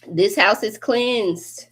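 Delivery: slow proclamation. Real, not acted. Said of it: "declares cleansing".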